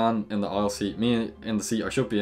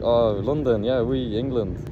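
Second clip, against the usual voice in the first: lightly mimicked French man, louder; imitated French accent; 3 semitones higher, 4 dB louder, slower than usual. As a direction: play an eager Frenchman mixing in French. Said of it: "This French fella comes over".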